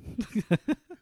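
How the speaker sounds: a short real laugh, high and rising. Real, not acted. Guest laughing at being nicknamed the questioner, real laugh. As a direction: laugh it off, amused.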